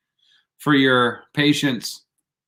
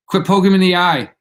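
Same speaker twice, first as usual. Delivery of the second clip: louder, fast mock scold; 5 semitones higher, 5 dB louder, faster than usual. Streamer scolds eye doctors, loud and fast. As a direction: tell them off, quick and loud.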